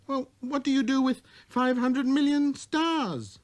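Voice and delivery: put-on high, light boy's voice; little prince voice. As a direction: speak like a wondering small boy.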